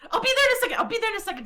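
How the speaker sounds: high, loud call easing down; frantic reassurance. Acted. Gris calls over to injured Ari, frantic and high. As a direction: hurry to reassure a hurt friend from afar.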